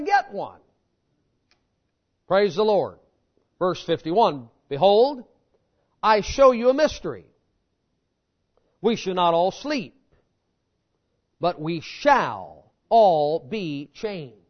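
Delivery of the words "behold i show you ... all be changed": solemn, measured Bible reading, slowing at the end; scripture reading. Acted. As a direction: read the verse with weight. Reads scripture aloud.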